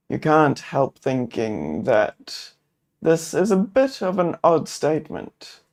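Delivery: ordinary talk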